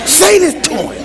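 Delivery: voiced imitation of an urging little demon; mock whispering tempter. Demon perched on your shoulder egging on a spouse, mock coaxing. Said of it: "They're whispering".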